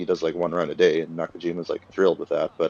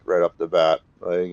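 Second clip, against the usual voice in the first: usual speaking voice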